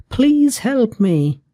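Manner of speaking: put-on high, slow little-girl plea; tiny girl pleading. A narrator's reading. Cold girl asks mouse.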